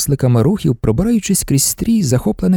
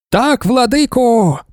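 high, slow fervent reply; women shouting; 8 semitones higher, 3 dB louder, slower than usual. A narrator's reading. Women answer their lord in a high, drawn-out shout. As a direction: shout back in devoted unison. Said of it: "закричали жінки".

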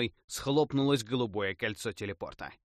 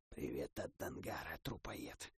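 low, much softer hiss; 7 semitones lower, 14 dB quieter than usual. A narrator's reading. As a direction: hiss it into his ear. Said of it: "прошипел убийца".

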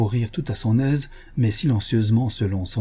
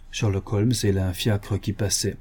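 ordinary delivery

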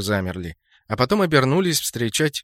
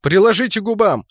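loud, high instruction; barked order; 7 semitones higher, 5 dB louder than usual. A narrator's reading. Leader tells squad to fake drinking, barked order. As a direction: bark it out so all obey. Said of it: "гаркнул я".

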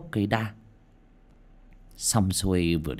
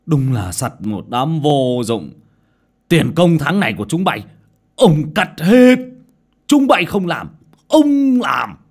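higher, louder tirade, slow on the threats; angry boss; 6 semitones higher, 9 dB louder, slower than usual. A narrator's reading. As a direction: punish the lazy workers, building to fury. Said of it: "Lão chủ thầu tức giận". (chủ thầu tức giận quát, cao và to dần)

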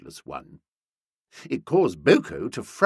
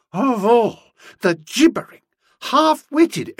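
high, loud, halting indignation; sputtering outrage; 12 semitones higher, 6 dB louder than usual. A narrator's reading. Nobby breaks into Boko's account, outraged. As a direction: explode in disbelief word by word.

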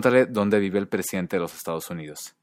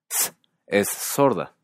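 ordinary delivery

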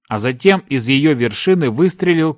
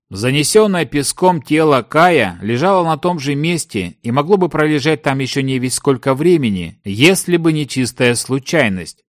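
ordinary delivery

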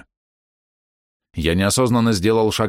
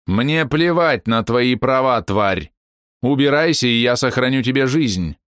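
angry threat; 5 semitones higher, slower than usual. Acted. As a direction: threaten with cold rage. Old woman threatens the demon.

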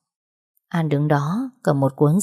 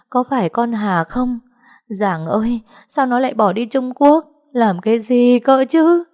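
mother sobbing out the bad news; choked with tears; 6 semitones higher than usual. A narrator's reading. Mother telling Huyền her sister vanished: tearful, choked. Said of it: "nghẹn ngào mà nói".

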